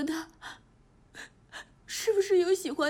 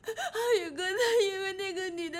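crying; 3 semitones higher than usual. Acted. Niece complains that he scolded her, crying. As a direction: wail the complaint through sobs. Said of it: "别哭，别哭".

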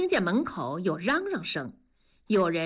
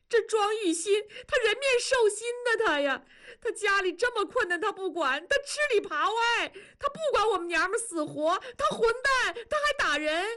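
much higher, crying pleas tumbling out; sobbing wail; 12 semitones higher than usual. Acted. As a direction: wail your grievances to the foreman through sobs. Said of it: "庄嫂痛哭流涕喊道". (向孙师傅哭诉丈夫，痛哭流涕地喊)

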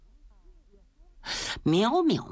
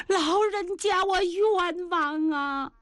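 drawn-out, high wail of grievance; crying out wronged; 9 semitones higher, slower than usual. Acted. The girl weeping to the old Taoist, slow crying wail. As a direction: sob it out slowly like she is wronged. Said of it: "别，别，别，别哭。".

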